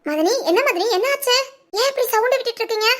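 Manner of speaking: high-pitched, agitated questioning; upset remonstration, pitch climbing; alarmed protest. Acted. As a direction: plead to know what is wrong. Alarmed protest at sister-in-law's outburst.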